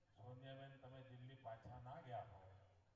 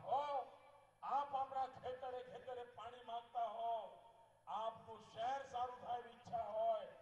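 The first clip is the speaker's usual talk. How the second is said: higher, louder rising list of appeals; rally appeal; 8 semitones higher, 7 dB louder than usual. Real, not acted. Politician asking the crowd for five years, high and loud. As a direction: build the appeal line by line to a climax.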